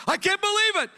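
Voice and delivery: high, loud cry of disbelief; mock shock. Believer stunned at own sin, high loud mock disbelief. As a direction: act out the believer's stunned dismay, pitched high. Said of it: "we go".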